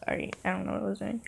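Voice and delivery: plain reading voice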